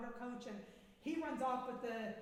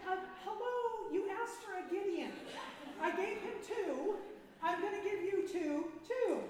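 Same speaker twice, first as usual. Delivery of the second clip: playful God voice; 8 semitones higher, 6 dB louder than usual. Acted. God voiced answering her prayer.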